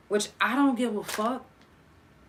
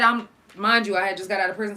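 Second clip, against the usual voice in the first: ordinary delivery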